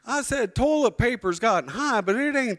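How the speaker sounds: loud, high-pitched mock outburst at the price; shocked protest. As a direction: holler a drawn-out whoa, then protest in a high voice. Acted shock at huge bill.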